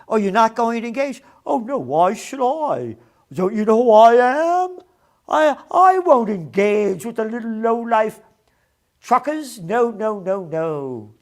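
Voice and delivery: high, loud sneering mock voice; mocking Trudeau. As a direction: sneer it like a spoiled brat.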